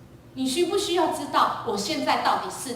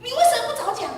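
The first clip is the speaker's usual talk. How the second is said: much higher, loud rebuke; angry father; 13 semitones higher, 8 dB louder than usual. Retold or imitated. Father rebuking his daughter for not speaking sooner, angry. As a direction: scold in exasperation.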